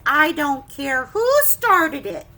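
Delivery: loud, slow fed-up scolding; teacher stopping a food fight, loud; exasperated teacher. Acted. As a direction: shut down the excuses. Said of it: "The teacher says".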